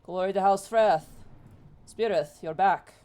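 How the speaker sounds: answering salute. Acted. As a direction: return the salute firmly, as a greeting. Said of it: "he stands up and goes".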